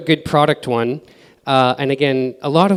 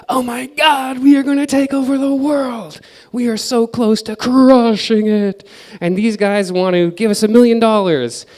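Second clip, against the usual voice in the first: high, over-the-top gushing startup hype; mock-excited founder, much higher pitched, words stretched out; 9 semitones higher, 5 dB louder than usual. Overexcited founder gushing about taking over the world, mock excited. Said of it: "super easy to get excited and be like".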